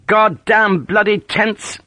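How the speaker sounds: disgusted curse. A narrator's reading. Sharp cursing the tents.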